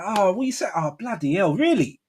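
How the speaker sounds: high, exaggerated matey reaction; put-on matey voice. Shown as a demonstration. Man interacting with people, in put-on matey voice. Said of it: "I can have the Cockney".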